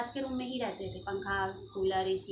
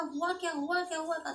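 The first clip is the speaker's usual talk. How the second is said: higher, urgent retold alarm as she rushes in; alarmed asking; 5 semitones higher than usual. Mother finding her beaten son asks what happened, higher and alarmed. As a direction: demand what happened, startled and afraid.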